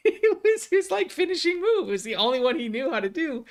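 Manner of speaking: high, laughter-squeezed voice; laughing while talking. Real, not acted. Fighter recalling how he caught the finishing move, real laughter. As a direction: crack up as you get the words out.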